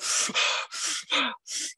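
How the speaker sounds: long unvoiced high breath out; big breathing sigh. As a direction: show the big Wim Hof breath. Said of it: "He goes".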